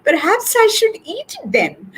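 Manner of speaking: very high, sheepish little voice; hammed-up squeaky embarrassment; embarrassed. A narrator's reading. Cat offers to eat the melted egg, embarrassed. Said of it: "orange fur turning red in embarrassment".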